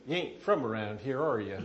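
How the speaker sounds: imitated western Kentucky local; lightly put-on Southern accent. Kentucky local questioning the newcomer, Southern accent. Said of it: "they all talk really funny".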